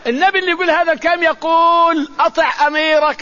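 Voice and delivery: emphatic preacher's call; forceful preaching. Real, not acted. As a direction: proclaim it to the crowd.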